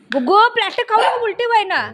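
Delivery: high-pitched, insistent scolding; urgent warning. Real, not acted. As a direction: plead sharply with a naughty pet, pitch up. Warning dog not to eat plastic.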